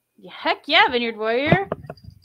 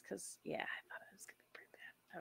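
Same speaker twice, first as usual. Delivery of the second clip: whispering; 25 dB quieter than usual. Real, not acted.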